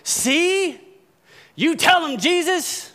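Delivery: high, mocking told-you-so voice; smug Pharisees. Pastor mimicking smug Pharisees egging Jesus on, high and mocking. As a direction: needle the sinner while cheering Jesus on. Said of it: "The Pharisees are going".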